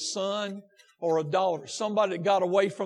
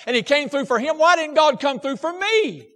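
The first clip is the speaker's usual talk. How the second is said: loud, raised, driving sermon voice; pressing each phrase on the congregation; impassioned preaching; 6 semitones higher, 9 dB louder than usual. Real, not acted. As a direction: preach it with force. Preaches at full force.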